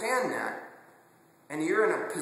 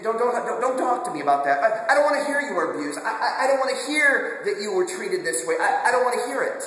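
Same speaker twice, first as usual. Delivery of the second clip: higher, louder, insistent don't-tell-me plea; raised refusing voice; 5 semitones higher, 5 dB louder than usual. Retold or imitated. People who refuse to hear, voiced louder and higher. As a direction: push the listener away, voice raised.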